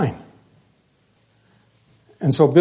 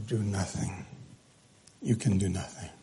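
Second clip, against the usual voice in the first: much softer and lower, letting it sink in; hushed solemn close; 6 semitones lower, 15 dB quieter than usual. Acted. Preacher driving home the last verse, hushed. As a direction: let the words fall away quietly.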